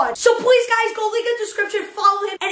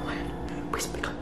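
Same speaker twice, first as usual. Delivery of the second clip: breathless whisper, much softer; scared whisper; 12 dB quieter than usual. Real, not acted. Begging Siren Head to pick up, whispered. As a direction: barely voice it, scared he'll hear. Waiting for scary caller.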